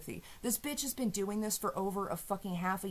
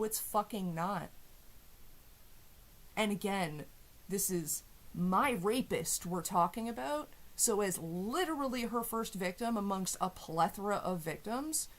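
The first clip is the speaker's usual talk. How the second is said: usual speaking voice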